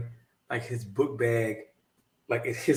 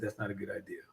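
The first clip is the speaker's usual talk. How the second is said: low, much softer calm warning; quiet warning; 4 semitones lower, 14 dB quieter, faster than usual. Retold or imitated. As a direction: defuse it under your breath. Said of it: "I said".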